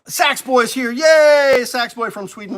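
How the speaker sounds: high, loud happy whoop; excited cheer. Real, not acted. Host cheering as his regular viewer Sax Boy joins, loud excited whoop.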